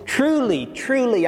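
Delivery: both words TRULY pitched high and hit hard; double emphasis. Shown as a demonstration. Pastor stressing Jesus' words to the congregation, emphatic. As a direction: lift and punch each Truly, falling away. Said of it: "double emphasis".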